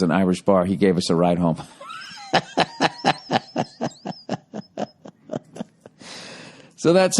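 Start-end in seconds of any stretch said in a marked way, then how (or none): 2.3-4.8 high, soft wheezing laugh; long staccato laugh, trailing off. Real, not acted. Comic cracking up at his ride-home story, real laughing.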